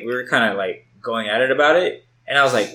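usual speaking voice

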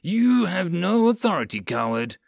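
high, indignant outburst; angry defiance. Acted. Relieved lieutenant defying sergeant, angry. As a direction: spit back the accusation.

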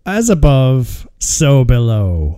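slow, drawn-out spooky title read; slow ominous title. Pretend. Host intoning the film title to cohosts, slow and ominous. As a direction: intone it like a horror trailer.

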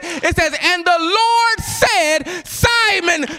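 high-pitched preacher's delivery building to a shout; impassioned sermon climax; full-force preaching. Real, not acted. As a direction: build the scripture into a ringing proclamation.

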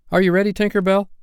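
calling out. A narrator's reading. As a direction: call up to the fairy. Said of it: "he called out".

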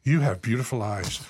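low, soft imitation of a biker; biker's come-on. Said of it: "looks at me and goes".

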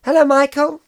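breezy English hello in a put-on British voice; English accent. GM as [name] greets [name] in an English accent. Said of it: "why are you talking in an English accent".

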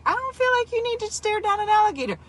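pleading with a fool, high and stunned; incredulous plea. Retold or imitated. Host pleading with the man on screen, high and incredulous.

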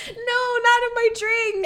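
high-pitched playful alarm; mock panicked protest. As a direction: cry out as if defending your drink. Acts out shielding her drink.

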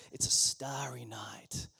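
whispered, soft and low; soft whispered scene-setting; hushed storytelling. Acted. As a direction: draw them into the starry night in a hush. Guided imagination, eyes closed.

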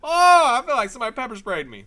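high, wailing cry, then rushed words; real yelp of stinging pain sliding down into complaint. Real, not acted. Podcaster with jalapeno rubbed in the eye, pained wail. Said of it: "I just rubbed jalapeno in my eye".

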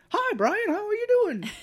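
squeaky high-pitched greeting; cartoonish falsetto hello; mock high voice. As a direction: chirp it way up high and bright. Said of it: "And he's like".

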